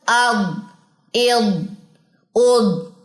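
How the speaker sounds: "अब, इब, उब" clearly articulated; syllable drill. Shown as a demonstration. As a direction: drill the vowels one by one. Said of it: "बार बार".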